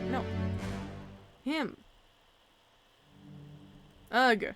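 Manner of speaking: ordinary talk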